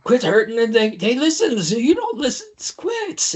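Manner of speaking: high-pitched, upset little voice; high, distressed plea without extra volume; pleading character voice. Acted. Game master voices upset character.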